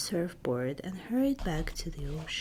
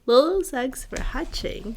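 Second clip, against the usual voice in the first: excited; 6 semitones higher, 8 dB louder than usual. Acted. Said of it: "she said excitedly".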